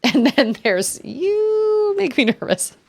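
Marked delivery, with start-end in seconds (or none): 1.0-2.8 high, slow, stretched-out put-on voice; playful high-pitched imitation, drawn out; mock game callout. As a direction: hold the first word long and high, then drop. Mimicking a game voice command again.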